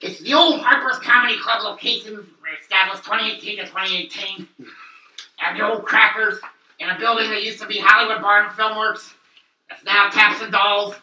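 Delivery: hammed-up ad read. Continues mock ad plug.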